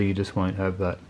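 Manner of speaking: usual speaking voice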